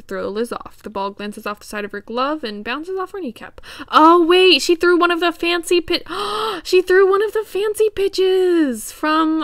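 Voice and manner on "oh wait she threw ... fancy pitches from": excited realization. Real, not acted. Realizing the special pitch.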